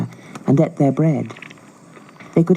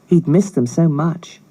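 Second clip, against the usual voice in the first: usual speaking voice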